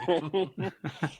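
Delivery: a high burst of real laughter. Real, not acted. Laughs at his own story.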